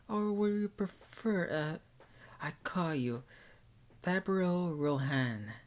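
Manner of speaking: Arquette. A narrator's reading.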